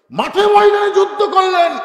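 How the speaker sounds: very high, very loud proclamation; rousing shout. Real, not acted. Preacher proclaiming who fought the war, rousing shout. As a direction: cry it out across the field.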